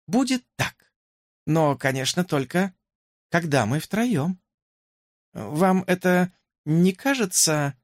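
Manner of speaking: shy princess. A narrator's reading. Princess voiced by narrator.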